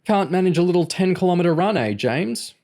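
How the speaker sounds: quick, high-pitched mockery; evil-grinning taunt. A narrator's reading. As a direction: needle him with malicious glee. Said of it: "breaking into an evil grin".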